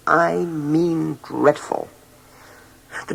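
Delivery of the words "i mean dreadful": "dreadful" drawn out slowly and weightily; emphatic dreadful. A narrator's reading. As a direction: lean hard into "dreadful", dragging it out. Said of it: "When I say dreadful".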